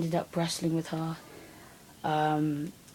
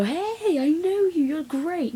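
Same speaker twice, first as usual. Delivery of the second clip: much higher, sing-song mock greeting; mock friendly greeting; 11 semitones higher, 3 dB louder, slower than usual. Mocking how people would greet her, in a much higher sing-song voice. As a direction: chirp it like a fake-friendly stranger. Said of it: "people just look at me and go".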